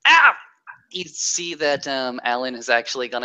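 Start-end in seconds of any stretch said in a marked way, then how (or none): 0.1-0.4 sudden high cry of pain; pained yelp. Acted. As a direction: yelp as the hand gets caught. NPC hand snapped in machine.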